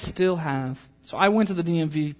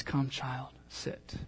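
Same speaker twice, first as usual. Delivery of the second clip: gentle fatherly voice; 3 semitones lower, 11 dB quieter, much slower than usual. Acted. God's gentle voice quoted.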